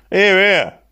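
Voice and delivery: a high, loud call of approval. A narrator's reading. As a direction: cheer the boss on from the benches. Workmen loudly approving the boss.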